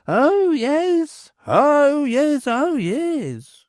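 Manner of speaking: high, ringing oyez call; crier's call. Acted. Bellman rounding off with his crier's call, ringing and high. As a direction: sing out like a town crier.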